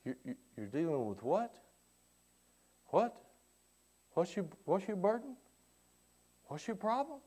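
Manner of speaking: soft, eager questions from a caring God; God urging the listener to share troubles, soft and coaxing. Acted. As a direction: lean in and coax the burden out. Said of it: "He says".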